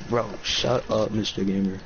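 ordinary talk

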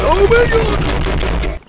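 long high drawn-out shriek; real terror, stretched out; terrified scream. Real, not acted. Jump scare in horror game.

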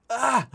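sudden high loud ah; startled yelp. Real, not acted. Teacher startled by his own program, yelping. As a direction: jump as if yelled at. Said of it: "I yelled at myself".

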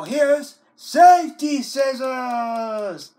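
loud slow announcement of So here's safety scissors; proud shout. Acted. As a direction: boom the hero's arrival. Announcing the punishment.

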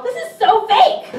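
mock yell. Hypnotist showing volunteer the line to yell, high mock yell. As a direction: pitch it up like a pretend yell. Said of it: "you're gonna yell".

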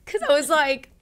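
almost crying while remembering; tearful. Real, not acted. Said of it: "I'm gonna cry thinking about it".

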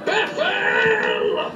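strained, high-pitched yell held back in volume; drawn-out pretend scream; mock scream. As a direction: cry the name out as if screaming, without full volume. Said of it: "And screams".

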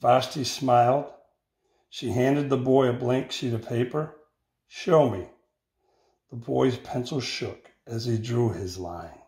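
put-on expressive read-aloud for children; picture-book reading voice; story narration. A narrator's reading. Teacher reads picture book aloud.

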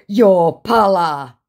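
slow, loud, high put-on voice; drawn-out mock scammer retort; hammed-up insult.

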